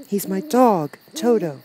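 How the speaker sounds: put-on high-pitched child's reply; Dorothy, little girl. A narrator's reading. Dorothy answers.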